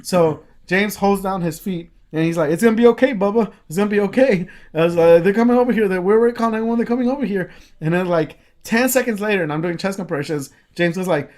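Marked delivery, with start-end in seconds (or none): 2.5-4.5 comforting. Retold or imitated. James comforting the shot man. 5.2-7.5 urgent. Retold or imitated. Shouting reassurance during compressions.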